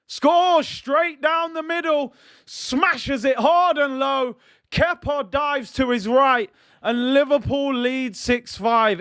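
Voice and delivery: real commentator's celebration; excited call. Real, not acted. Jota scores, commentator erupts.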